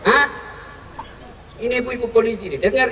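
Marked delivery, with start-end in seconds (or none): none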